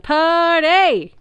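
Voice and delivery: high loud stretched yell; wild high party holler; party whoop. A narrator's reading. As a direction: whoop it to the sky. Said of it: "a voice wailed".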